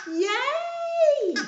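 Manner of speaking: high excited yay; excited cheer. Real, not acted. Cheering the story title.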